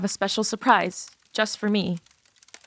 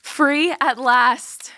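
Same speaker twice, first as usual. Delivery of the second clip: slow, loud triumphant release; freed cry; 4 semitones higher, 5 dB louder, much slower than usual. Acted. Jackie casting off her scarf, slow loud triumph. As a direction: savor each word like breaking chains.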